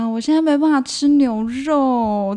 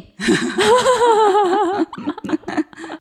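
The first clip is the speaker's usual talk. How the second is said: laughing; 11 semitones higher, 3 dB louder than usual. Real, not acted. Laugh mid answer.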